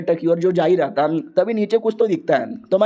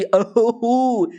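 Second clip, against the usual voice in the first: drawn-out gasp of wonder; 5 semitones higher, much slower than usual. Acted. Hero realizes he might be legend.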